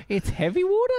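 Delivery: high, laughing question; amused disbelief. Real, not acted. Co-host laughing at the film title, amused disbelief. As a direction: giggle the title back in disbelief.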